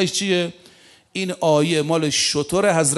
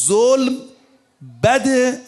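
sermon outburst against injustice; emphatic outcry; 7 semitones higher, much slower than usual. Real, not acted. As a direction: call out the words with force.

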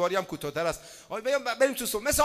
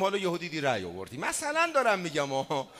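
normal talking voice

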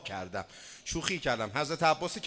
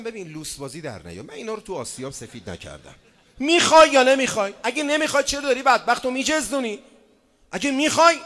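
mock ultimatum; 4 semitones higher, 7 dB louder than usual. Retold ultimatum to suitor.

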